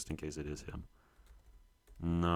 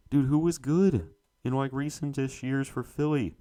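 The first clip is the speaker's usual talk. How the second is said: higher, louder, rushed plea to himself; panicked question; 7 semitones higher, 5 dB louder than usual. Real, not acted. Player racing the quiz timer, higher panicked plea. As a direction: sound like the clock is beating you.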